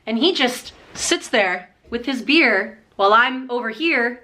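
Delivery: ordinary delivery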